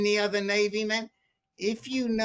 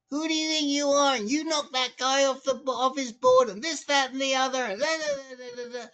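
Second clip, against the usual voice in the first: higher-pitched mock scolding, running off into da-da-da; imitating yelling; 6 semitones higher than usual. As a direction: rattle off a stranger's rant, pitched up. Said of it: "starts yelling at me".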